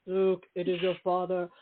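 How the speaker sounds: a hint of the famous villain line; James Earl Jones. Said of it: "it's not James Earl Jones".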